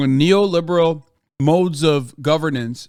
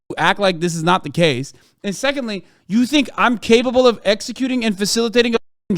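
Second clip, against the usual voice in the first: ordinary delivery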